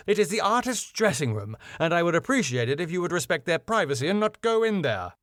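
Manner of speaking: firm objection. Acted. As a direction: object politely but firmly, quickly. Acted objection in script read-through.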